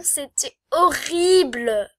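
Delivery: « horrible » étiré très lentement et fort; traîner sur « horrible » pour insister; horrible appuyé. Shown as a demonstration. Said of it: "Quand je dis horrible". (« horrible » lent, plus fort, appuyé)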